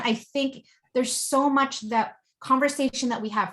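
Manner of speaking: ordinary talk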